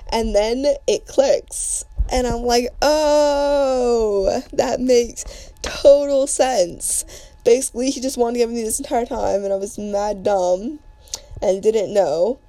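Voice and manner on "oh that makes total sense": long, high "oh" of realization; dawning realization. Retold or imitated. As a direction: act out it finally clicking, slow and higher. Acts out her sudden realization.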